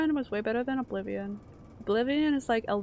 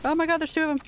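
higher, louder startled exclamation; alarmed; 6 semitones higher, 6 dB louder, much faster than usual. Real, not acted. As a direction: blurt it out as enemies appear. Startled by enemies in game.

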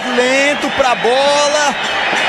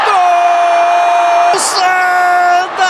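goal shout; 2 semitones higher, much slower than usual. Real, not acted. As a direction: belt out the goal call. Goal scored, commentator calls.

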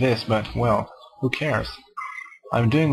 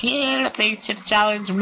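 high squealing mock-mad voice; crazed babble; 10 semitones higher than usual. Gamer answers puzzled people, mock-insane babble. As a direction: squeal it high, dissolving into silly gibberish.

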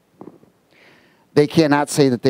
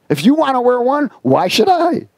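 indignant retort; 11 semitones higher than usual. Retold or imitated. Preacher retorting to the masked stranger, high and indignant. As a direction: push back at the stranger, rising. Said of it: "I said to the guy".

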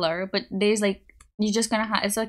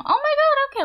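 surprised; 7 dB louder than usual. Real, not acted. Surprised at chat time.

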